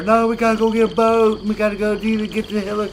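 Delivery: high, loud, bossy mock teammate voice; hammed-up clueless teammate; mocking newbies. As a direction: mimic the know-it-all newbie. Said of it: "they're getting on motorcycles".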